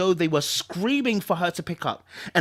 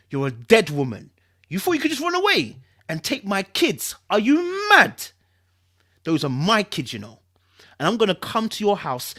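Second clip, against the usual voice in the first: louder, rising to a higher enraged yell; furious threats; 4 semitones higher, 4 dB louder than usual. Acted. Ex-husband threatening Sky down the phone, furious rising yell. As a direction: menace building into a shouting rage.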